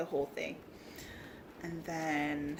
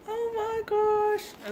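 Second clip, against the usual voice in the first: much higher and slow; slow squeal; 5 dB louder, much slower than usual. Real, not acted. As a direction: draw it out, delighted. Squealing over craft.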